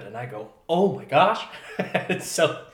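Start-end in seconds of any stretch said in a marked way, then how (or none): 0.7-1.4 laughing, higher and louder burst; startled exclaim. Retold or imitated. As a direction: blurt it out in startled delight. Sees leopard alert, startled.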